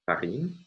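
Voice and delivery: "farine" stressed on its last syllable; stress on final syllable. Shown as a demonstration. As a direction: say "farine" as French, weight on "rine". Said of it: "it falls in the final syllable".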